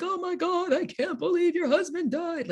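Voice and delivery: high over-dramatic sob-story voice; mock wailing. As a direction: ham up a gushing mourner. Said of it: "be like".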